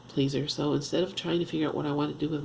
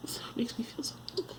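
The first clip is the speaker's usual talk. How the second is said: tearful whisper; 5 semitones higher, 9 dB quieter, faster than usual. Real, not acted. Woman admitting guilt about her past, in a tearful whisper. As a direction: voice gone to a high, faint whisper. Guilt over mom, fighting tears.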